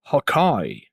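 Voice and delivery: sly low persuasion; persuading. Shown as a demonstration. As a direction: coax them, low and easy. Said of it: "sounds like somebody trying to persuade somebody".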